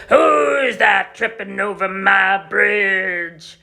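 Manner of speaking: slow, loud troll roar; menacing troll shouting at the goat; greedy troll. Acted. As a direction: bellow it slowly like a hungry troll.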